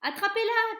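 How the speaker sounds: high, loud urgent yell; shouting crowd. A narrator's reading. Kitchen staff chasing the mouse, high loud yell. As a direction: bark the order at the mouse. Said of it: "criaient les gens".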